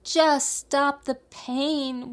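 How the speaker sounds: high, slow, whimpering plea. A narrator's reading. Aching fox pleading with the dentist, whimpering. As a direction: whimper through tears. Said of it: "whimpered the fox".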